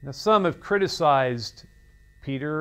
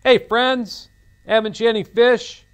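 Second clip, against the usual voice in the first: louder, higher cheerful call across the water; friendly call; 7 semitones higher, 7 dB louder than usual. Retold or imitated. Jesus calling to the fishermen, warm and loud. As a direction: hail old friends from the shore. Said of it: "that's kind of what Jesus is saying".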